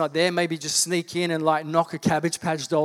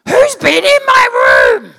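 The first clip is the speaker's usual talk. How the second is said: much higher, loud shout of an outraged sister; mock furious shout; 14 dB louder, slower than usual. Sister shouting on finding her room messed with, in a high mock yell. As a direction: bellow it as the wronged sister, drawn out.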